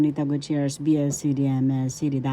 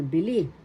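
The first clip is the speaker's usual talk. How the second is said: "bili" with the accent on "li"; bi-LI, modelled for learners; stress on second syllable. Shown as a demonstration. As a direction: lean on the end of "bili". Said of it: "The accent is in the second syllable".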